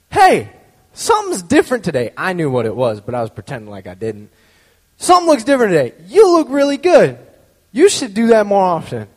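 high, loud, eager praise; loud compliment. Retold or imitated. Student on his chair praising the grumpy teacher, high and loud. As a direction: gush it up at her.

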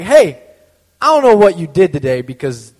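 a loud call across the class; yelled at teacher. Retold or imitated. Student standing on a chair calls to the teacher, loud. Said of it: "I yelled at the teacher".

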